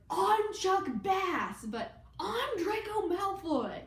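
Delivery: high, slow sneering drawl; hammed-up snooty teen villain; mock Malfoy voice. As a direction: ham up a smug rich boy, high and slow.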